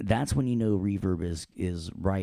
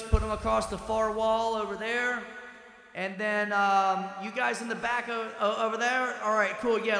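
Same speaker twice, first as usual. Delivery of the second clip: much higher, echoing announcer in a hall; cathedral echo voice; 13 semitones higher than usual. Pretend. Teacher pretending to direct a crew in a huge hall, high and echoing. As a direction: call out like a stagehand across a hall. Voice with big reverb effect.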